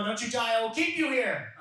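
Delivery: high, hammed-up desperation; urgent mock plea. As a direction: plead hard with a dying patient, played for laughs. Acted desperate plea to dying grandma.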